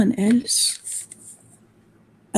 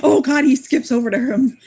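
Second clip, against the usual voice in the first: normal talking voice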